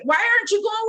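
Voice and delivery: mock angry yell. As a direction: yell at the donkey that won't move. Yelling at a stalled ride.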